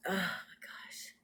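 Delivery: slow, breathless whispered awe; stunned whisper. Real, not acted. Vlogger overwhelmed by the coincidence, whispering in awe. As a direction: whisper it in disbelief. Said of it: "What are the chances of this?".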